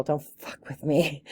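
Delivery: slow, deliberate don't-mess-with-me line; quoting her people's motto, slow and tough; tough warning. Retold or imitated. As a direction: lay it down slowly like a street warning. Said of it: "in the words of my people".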